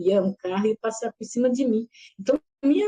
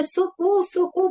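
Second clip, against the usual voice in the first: retold cry for help; 8 semitones higher than usual.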